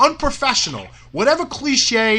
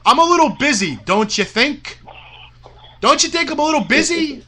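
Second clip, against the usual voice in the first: higher-pitched, pointed rhetorical jab; indignant sarcasm; 7 semitones higher than usual. Real, not acted. Promoter answering Bill's complaint about not coming over, indignant sarcasm. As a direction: throw it back as an exasperated rhetorical question. Indignant retort to absent Bill.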